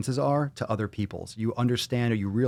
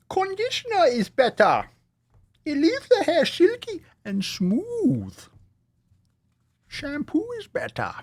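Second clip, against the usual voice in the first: much higher, hammed-up ad announcer; silly shampoo ad voice; slower than usual. Host riffing a fake hair commercial, high hammed-up ad voice. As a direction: sell the hair product like a cheesy commercial, up high. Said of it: "tried not to, like, yell that into the microphone".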